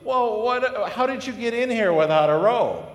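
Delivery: high, loud startled king, caught off guard; the king stops short, shocked to see a guest without a robe. Acted. King confronting the robeless guest at his party, high and startled. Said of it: "And the king goes".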